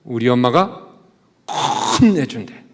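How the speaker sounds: put-on loud, defiant child shouting up at a bigger kid; proud little boy. Little boy boasting to the older kid, loud and defiant. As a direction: puff out your chest and boast like a four-year-old. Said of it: "그 형 얼굴에 대고 뭐라 그럴까요?".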